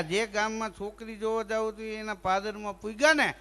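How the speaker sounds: normal talking voice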